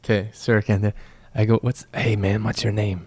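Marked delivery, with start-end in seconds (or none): 1.6-3.1 soft, hushed aside; leaning in to whisper. Acted. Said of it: "I whisper in his ear".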